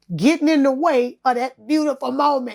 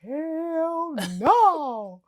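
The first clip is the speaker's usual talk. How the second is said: high, laughing disbelief; 5 semitones higher, much slower than usual. Retold or imitated. Shrugging off the online mob, laughing. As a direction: laugh helplessly at the fuss. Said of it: "I be laughing like".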